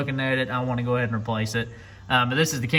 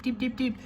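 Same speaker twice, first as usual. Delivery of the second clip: light high tapping sounds, softly; tapping noise; 10 semitones higher, 7 dB quieter than usual. Shown as a demonstration. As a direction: mimic tiny hammer taps, high and soft. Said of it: "You don't have to come in here and teep".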